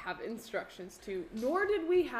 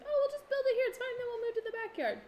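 much higher, light and quick, no worries; airy, much higher "it's fine" voice; breezy careless self; much faster than usual. Retold or imitated. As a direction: play your carefree past self, brushing it off. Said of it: "because I was like".

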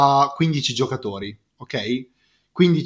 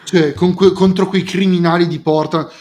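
louder, higher heated rant; emphatic italian speech; 5 semitones higher, 6 dB louder than usual. Real, not acted. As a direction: fire back hotly. Heated basketball argument.

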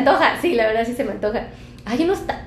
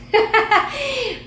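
high burst of laughter; 10 semitones higher than usual. Real, not acted. Laughs at patitas joke.

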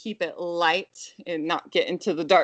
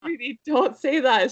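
higher mock-sweet correction; gently scolding mom; 6 semitones higher than usual. Mom corrects her swearing toddler, high gentle scolding. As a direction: chide a small child sweetly, in imitation.

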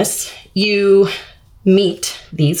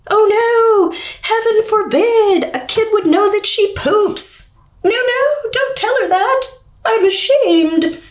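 much higher, shrill hammed-up panic; mocking prudish parent; 13 semitones higher than usual. Parent opting out of health class, hammed-up mock horror. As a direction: sarcastically play a scandalised parent.

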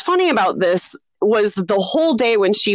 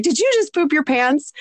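joking question; 6 semitones higher than usual. Retold or imitated. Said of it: "I said it as a joke".

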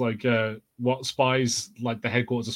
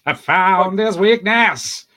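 slow, loud, higher mock-sinister reveal; mock villain; 7 semitones higher, 6 dB louder, much slower than usual. Teasing a friend in a slow, loud mock-villain voice. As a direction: gloat like a Bond villain finding a weakness.

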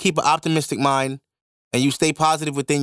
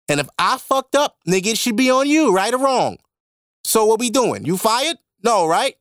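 raised, higher and louder, pressing the point; heated retort; 8 semitones higher, 3 dB louder than usual. Retold or imitated. To the manager who fired him, indignant raised voice. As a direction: argue it hot, voice climbing.

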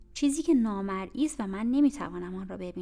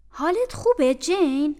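concerned maid; 6 semitones higher, 9 dB louder than usual. Acted. Bessie checking on the girl.

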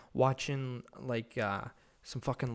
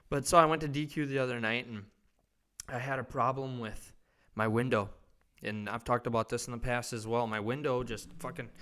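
usual speaking voice

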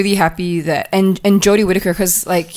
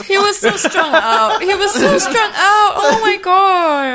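high, slow and laughing with delight; giggling, higher and slower, overcome by the memory; laughing swoon; 11 semitones higher, slower than usual. Real, not acted. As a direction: swoon over him while laughing. Swooning over the actor.

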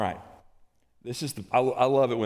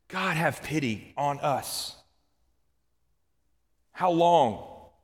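drawn-out, raised plea to God; pleading outburst; 5 semitones higher, much slower than usual. Acted. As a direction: beg slowly, with heavy pauses. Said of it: "Moses has a little outburst here".

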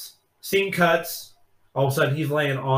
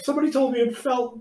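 high, sheepish excuse voice; mock lame excuse; 10 semitones higher, faster than usual. Caught guy giving stepmom a lame high excuse.

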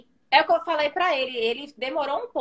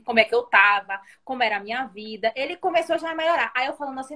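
ordinary talk, nothing marked

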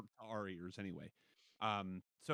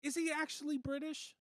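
high-pitched disbelief, rising at the end; genuinely taken aback; incredulous question. Real, not acted. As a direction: ask it in astonishment, voice pitched way up. Astonished on learning of British accent.

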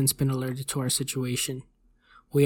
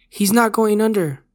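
high, loud shout in alarm; urgent yell; 7 semitones higher, 8 dB louder than usual. A narrator's reading. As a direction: yell it urgently to the surgical team. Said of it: "the anesthesiologist yelled".

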